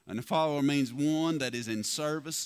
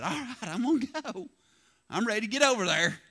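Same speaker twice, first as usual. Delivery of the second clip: higher and faster, hammed-up eagerness; mock eager Jonah; 8 semitones higher, 5 dB louder than usual. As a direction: play a falsely keen Jonah rushing off.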